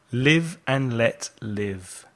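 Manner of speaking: normal talking voice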